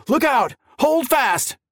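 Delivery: urgent warning. A narrator's reading. Warning shouted as trolley hits.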